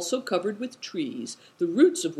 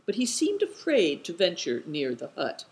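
usual speaking voice

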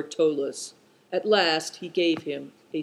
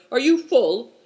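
higher, louder sudden question; 7 semitones higher, 7 dB louder than usual. A narrator's reading. Old man suddenly demanding of his guests, loud. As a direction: bark the question at them. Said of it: "said Tolas suddenly".

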